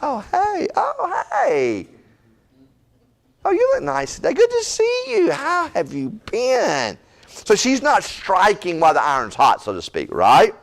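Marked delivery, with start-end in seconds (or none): 0.0-7.0 high, sugary greeting, slowing at the end; sweet-talking Esther. Retold or imitated. Esther charming the king with a high, sugary hello. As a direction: coo at him all sweetness, pitched way up. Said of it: "gives them the little".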